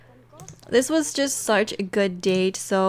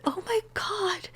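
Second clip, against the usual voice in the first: slow stunned whisper; aghast hush; shocked whisper; 10 semitones higher, 6 dB quieter, slower than usual. Real, not acted. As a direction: breathe it out in disbelief. Shocked that baby aged up.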